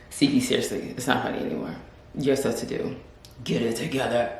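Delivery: soft, low self-scolding. Real, not acted. Vlogger scolding herself to stop laughing, soft and low. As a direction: quietly tell yourself off. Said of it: "scolding herself".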